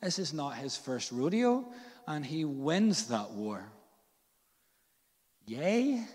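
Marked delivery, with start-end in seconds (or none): 5.5-6.0 high, rising questioning yay; doubtful little celebration; half-hearted cheer. Acted. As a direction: cheer as if unsure it is good news. Half-hearted cheer at civil war.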